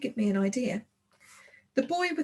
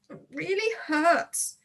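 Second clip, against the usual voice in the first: slow, high, pained gasp; 7 semitones higher, slower than usual. Acted. Injured girl gasping to her friend about the sting. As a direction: squeeze it out through the hurt. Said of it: "Tulip gasped".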